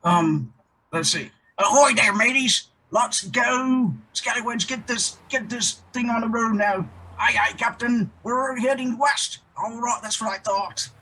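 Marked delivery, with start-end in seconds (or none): 1.6-9.4 high, hearty pirate captain. Acted. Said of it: "your character voice". 9.6-10.9 high, quirky sailor sidekick; pirate crew member voice. Acted. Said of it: "his crew member, Noodler".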